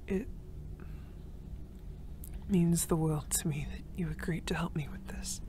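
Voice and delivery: soft, voiceless, intimate whisper; tender whisper. Acted. Grateful friend thanking the rescuer for her mother's ring, tender whisper. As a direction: whisper heartfelt thanks up close.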